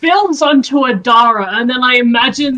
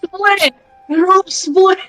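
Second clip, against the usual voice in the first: excited; 8 semitones higher, slower than usual. Real, not acted. Players react to party splitting.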